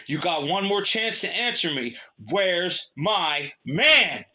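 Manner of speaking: loud, high, very slow commanding scream; screaming with authority. Acted. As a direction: scream the final warning. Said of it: "the soldier screamed with authority".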